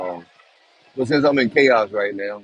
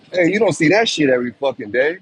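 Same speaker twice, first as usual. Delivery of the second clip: usual speaking voice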